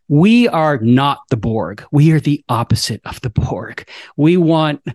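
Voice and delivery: ordinary talk, nothing marked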